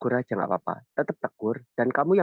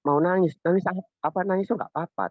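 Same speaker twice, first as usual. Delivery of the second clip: pitched high, a bit quick, gently urging; raised voice to child; 9 semitones higher, faster than usual. Retold or imitated. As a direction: lift the voice high as if reassuring a child. Father quoting his advice to child. (suara tinggi menenangkan anak)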